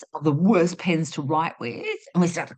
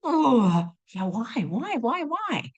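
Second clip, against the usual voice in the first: excited brain; 5 semitones higher, slower than usual. Acted. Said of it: "your brain goes".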